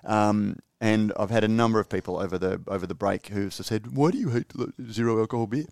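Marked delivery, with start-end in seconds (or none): 4.0-5.7 murky foggy voice on the quote; a foggy put-on voice for the question. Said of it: "I shouldn't use my fog voice".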